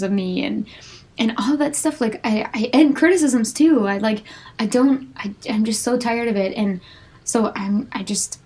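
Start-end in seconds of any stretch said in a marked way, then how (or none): none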